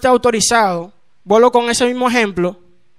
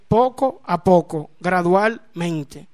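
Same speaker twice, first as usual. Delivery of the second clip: slowing, sinking softer and lower; gradual calming; 5 semitones lower, 5 dB quieter, much slower than usual. Retold or imitated. Preacher telling how the woman calmed down, slowing and softening. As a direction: ease down word by word.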